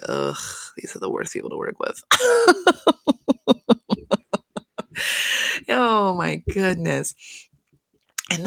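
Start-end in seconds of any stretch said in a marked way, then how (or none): 0.1-2.0 low, hushed grumble, quick and under the breath; imitated photographer. Imitating the poor photographer walking in on clients, low hushed grumble. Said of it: "when he walked in, he's like". 2.1-5.6 long, high peal of real laughter; hard laughter. Real, not acted. Podcaster laughing at her photographer imitation, long real high laugh.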